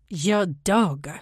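put-on man speaking with a German accent. A narrator's reading. German man stops her from behind about the dog, German accent. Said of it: "The accent is German".

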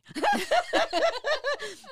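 high loud giggle; squealing laugh. Real, not acted. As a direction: laugh at the awkward moment. Laughing at own story.

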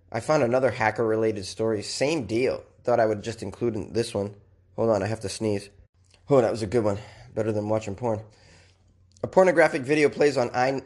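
usual speaking voice

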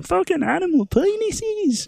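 high, drawn-out silly voice; in-head silly voice. Retold or imitated. Retelling what went through the head at work, silly high voice. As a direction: stretch it out in a high, goofy squeal. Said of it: "in my head going".

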